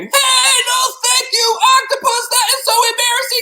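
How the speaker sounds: very high, loud frantic protest; embarrassed boy. A narrator's reading. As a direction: yell it like an embarrassed kid.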